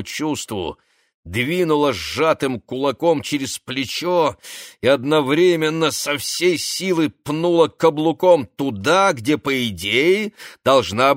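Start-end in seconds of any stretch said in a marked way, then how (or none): none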